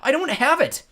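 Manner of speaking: high, loud denial; panicked protest. A narrator's reading. Panicked shouting over the eye.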